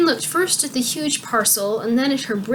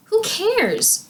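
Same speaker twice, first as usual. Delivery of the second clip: dismissive; 12 semitones higher than usual. A narrator's reading. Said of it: "Igraine shrugged her shoulders".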